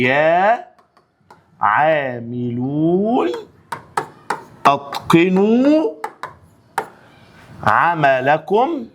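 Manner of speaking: each word spaced out, very slow; slow dictation. Shown as a demonstration. Teacher slowly dictates the plural answer to students. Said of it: "قال لك بكل بساطة".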